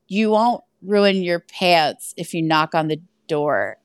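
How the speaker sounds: low, slow, awkward imitation of husband; adorably awkward. Husband reassuring guest about her pants, adorably awkward. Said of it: "says this in the most adorably awkward way".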